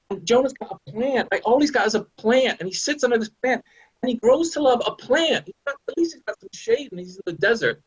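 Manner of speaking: high, worked-up storytelling; building indignation. Real, not acted. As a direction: tell it with rising outrage. Rising indignant retelling of Jonah.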